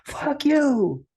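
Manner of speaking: hammed-up yell at the drunk. Retelling [name] yelling back at the drunk guy, a mock shout. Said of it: "you just kept raising your voice".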